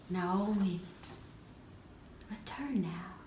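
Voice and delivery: soft, low, breath-led guidance; guided meditation. Acted. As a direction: guide her back gently, in a hush. Guide leads her back, meditation.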